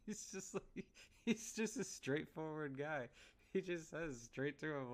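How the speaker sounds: words squeezed out through real laughter; laughing while talking. Real, not acted. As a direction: keep laughing as you explain it. Laughing while retelling the scene.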